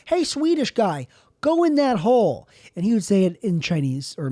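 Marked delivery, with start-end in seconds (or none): 0.0-2.5 high-pitched, urging call to someone. Acted. Imagined guy at the hole urging another to go in, calling out. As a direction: egg him on, pitched well up. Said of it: "you're gonna say".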